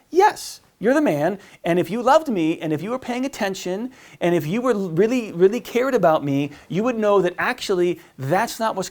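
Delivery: high, indignant mock insistence; imitated angry wife. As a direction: play a wife scolding her husband. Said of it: "she's angry at him".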